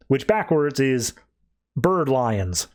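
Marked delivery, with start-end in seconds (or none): none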